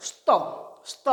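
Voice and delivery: "স্ত" with s running straight into t; joined স্ত. Shown as a demonstration. Teacher sounding out the conjunct, স্ত as a single "sto". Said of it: "উচ্চারণ হবে".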